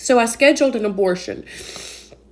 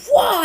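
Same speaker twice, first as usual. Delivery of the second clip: a higher, playful "whoa" as the fireball flies; mock energy blast whoosh; 12 semitones higher than usual. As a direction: toss off a cartoon fighting-game blast noise. Imitating Mortal Kombat energy blast.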